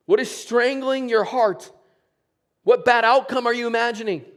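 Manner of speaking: high, loud, urgent sermon questions; preaching at full force. Acted. Pastor challenging the congregation, loud and urgent. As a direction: press every question home from the pulpit.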